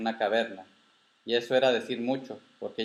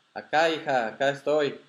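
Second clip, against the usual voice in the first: higher, projected answering call; calling back; 4 semitones higher, 4 dB louder than usual. Acted. Grandfather answering the grandchild from inside the cavern, calling back. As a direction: call out so you can be found.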